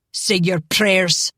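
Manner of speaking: slow, heavy bellow of a threat; slow menacing bellow. Acted. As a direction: roar it out slowly like an enraged father. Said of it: "Angus bellowed".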